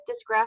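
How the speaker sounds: Dysgraphia said high, as a question; unsure pronunciation. Shown as a demonstration. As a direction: try out the word Dysgraphia, checking it. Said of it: "Did I say that right".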